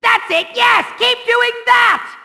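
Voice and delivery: high, excited cheering-on; shrieked villain encouragement; frantic urging. Acted. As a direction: egg on your rescuer. Urging on the rescuer.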